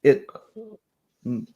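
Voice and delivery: ordinary talk, nothing marked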